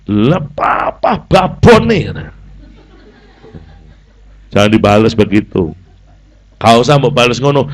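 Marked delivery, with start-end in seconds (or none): 0.1-2.3 slow, laughing sassy comeback; mock cheeky retort. Child talking back to the scolding father, laughing. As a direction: sass the parent back. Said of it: "Ojo mau bales".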